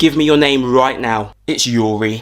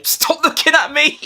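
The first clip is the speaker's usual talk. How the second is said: shrill, much higher telling-off; high sharp snap; 11 semitones higher than usual. Acted. Teacher to staring pupils, sharp high snap.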